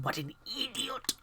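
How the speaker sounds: unvoiced self-scolding whisper; whispered aside. Note writer cursing herself, whispered aside. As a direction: whisper it to yourself.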